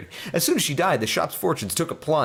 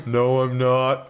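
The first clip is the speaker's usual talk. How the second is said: normal talking voice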